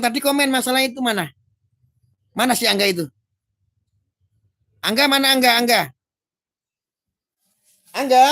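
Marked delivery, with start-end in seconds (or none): 4.8-8.2 loud call across the stream. Real, not acted. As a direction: call his name out, louder, rising. Calling out to a viewer. (memanggil dengan keras)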